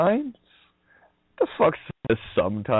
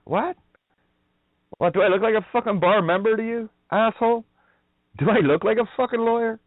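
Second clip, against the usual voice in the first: mock outraged retort; 12 semitones higher than usual. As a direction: throw the question back at him with contempt. Acted retort to the goons.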